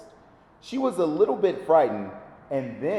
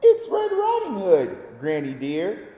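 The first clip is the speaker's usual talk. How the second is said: squeaky little voice. Acted. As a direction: squeak it out sweetly like a little girl. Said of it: "a squeaky little voice said".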